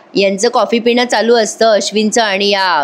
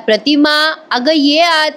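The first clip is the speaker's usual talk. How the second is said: mild calling in; 5 semitones higher, slower than usual. A narrator's reading. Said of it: "हाका मारतात".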